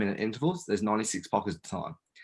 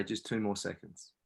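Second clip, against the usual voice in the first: inner guidance; 3 semitones lower, 9 dB quieter than usual. Acted. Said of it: "find the inner guidance within yourself".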